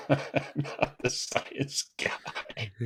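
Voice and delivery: drawn out through laughter; laughing. Real, not acted. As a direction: crack up while repeating it, very slowly. Cracks up at co-host's mix-up.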